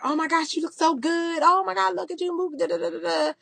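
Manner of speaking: high, sing-song imitation of gushing people; mock gushing compliments. Vlogger mocking people who suddenly compliment her, high gushing voice. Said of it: "everybody's like".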